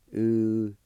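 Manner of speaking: slow, syllable by syllable with long gaps; sounded out. Shown as a demonstration. Teacher showing learners the first part of geyser, sounded out. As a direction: draw out each syllable separately. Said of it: "First part is pronounced like this".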